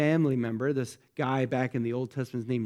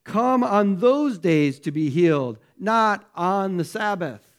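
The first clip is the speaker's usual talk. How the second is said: raised, higher and louder, slow and stern; indignant; 4 semitones higher, 6 dB louder, slower than usual. Acted. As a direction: scold the crowd like an offended official. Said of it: "was indignant".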